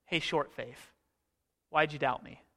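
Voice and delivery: soft, easy jab, "short faith" said like a joke; friendly razzing. Shown as a demonstration. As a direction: nudge him like a buddy. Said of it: "What if it's".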